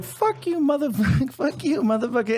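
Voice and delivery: half-laughing high mock shriek of a yelling woman; mock screaming neighbor. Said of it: "and she's like".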